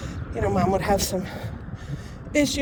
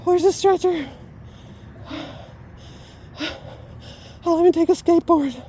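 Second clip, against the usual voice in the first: higher, breathless comic complaint; winded mock plea; 6 semitones higher than usual. Real, not acted. Winded walker jokingly calling to the doctor, out of breath. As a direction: gasp out each plea between long pauses. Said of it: "see what exercise does to me".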